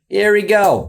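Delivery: here we go, psyching himself up; hyped. Real, not acted. Bracing to react.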